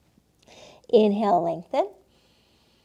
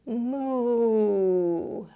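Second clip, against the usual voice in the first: cow moo. Pretend. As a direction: breathe out a hushed little moo. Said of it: "What does a cow say?".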